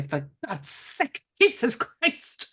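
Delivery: slow, drawn-out exasperated cry; lightly imitating a fed-up mum; exasperated mother. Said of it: "how many times she will say".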